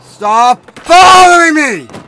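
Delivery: high, loud panicked yelling; panicking gamer. Real, not acted. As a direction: yell frantically at the screen. Chased in game, yelling.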